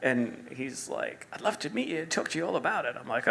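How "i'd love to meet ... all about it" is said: quick, high imitated Australian; Australian accent. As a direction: rattle it off in a lightly put-on Australian accent. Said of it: "that's pretty good with the accent".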